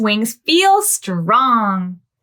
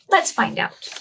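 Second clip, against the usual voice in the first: ordinary delivery, nothing marked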